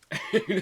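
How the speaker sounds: loud, high burst of laughter; real laugh. Real, not acted. Amused during the chat.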